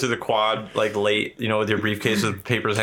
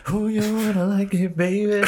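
high, playful come-on voice; high flirty voice; 11 semitones higher than usual. Pretend. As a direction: tease like a flirty diva. Mock flirty voice.

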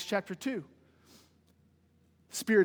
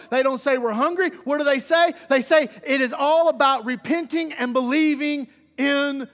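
loud, higher, ringing proclamation; preaching climax; 4 semitones higher, 6 dB louder than usual. Real, not acted. Preacher declaring what Paul and Silas say, forceful. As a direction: punch out the question, then proclaim the answer.

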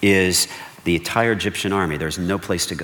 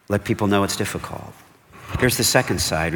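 ordinary talk, nothing marked

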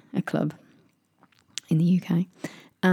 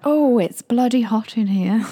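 high, loud, slow mock-British exclamation; drawn-out, hammed-up British complaint; amped-up British accent; 5 semitones higher, 5 dB louder than usual. Friend showing off to a bouncer, amped-up British accent. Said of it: "she amps up the British".

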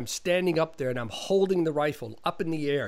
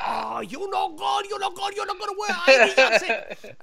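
John Woo; 5 dB louder than usual. Retold or imitated. Director throwing stand-in off set, mock furious shout. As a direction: imitate a director ranting in broken English. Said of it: "So he's like".

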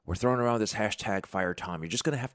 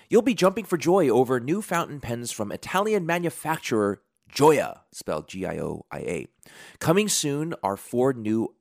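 higher, punchy sponsor read; 5 semitones higher, 5 dB louder than usual. Acted. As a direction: sell it with big emphatic openings.

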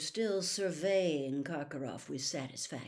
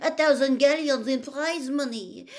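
high, loud, petulant protest; heated, high-voiced complaint; indignant outburst; 10 semitones higher, 10 dB louder than usual. A narrator's reading. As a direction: burst out passionately, pitched well up. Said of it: "burst out Fleur Delacour, stamping her foot".

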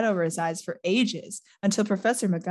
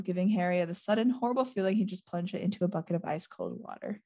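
ordinary talk

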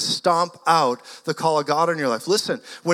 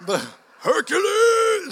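much higher, louder, slow drawn-out yell; mock battle cry; 4 dB louder, slower than usual. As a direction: joke hero shout. Repeating the joking battle cry.

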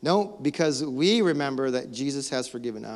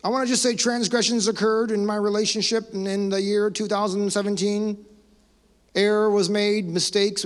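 mock pompous statement; 5 semitones higher than usual. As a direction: send up an empty official apology. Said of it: "How about this one?".

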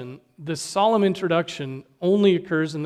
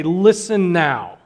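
slow, louder insistent appeal to listen; urgent solemn plea; 3 semitones higher, 6 dB louder, slower than usual. Pretend. Preacher voicing Jesus to the crowd, slow insistent urging. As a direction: press every word so they must listen. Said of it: "he uses these words to really say".

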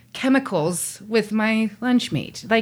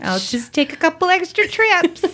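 higher fussy grandma voice; 8 semitones higher than usual. Retold or imitated. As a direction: put on a fussy grandma, pitched up. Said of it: "I hear my grandma's voice".

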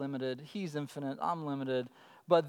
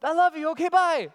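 put-on high, loud, quick-parting kid voice; imitated little girl; 8 semitones higher, 8 dB louder than usual. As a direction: blurt it like a child running off. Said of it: "And she'll be like".

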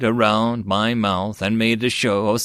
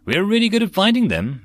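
proud boast, pitched higher and louder; 9 semitones higher, 3 dB louder than usual. Acted. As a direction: say it beaming with pride. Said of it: "it said with pride".

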